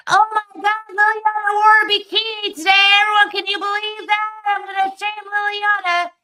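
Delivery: high, loud shrill-free mock outrage; mocking gossip voice. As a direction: squeal it like a petty public shamer. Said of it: "being like".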